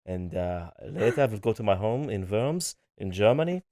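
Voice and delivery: hammed-up German, W's turned to V's; cartoonish German accent. Shown as a demonstration. Husband performs on request, cartoonish German accent. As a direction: play a cartoon German host inviting guests. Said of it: "I could do, like, a cartoonish German one".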